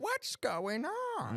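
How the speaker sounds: imagined grandma. Pretend. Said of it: "Was your grandma like".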